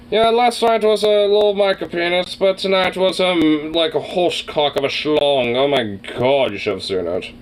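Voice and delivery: a hint of a snooty upper-class gossip; bougie posh accent. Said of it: "bougie, posh accent for, uh, for this character".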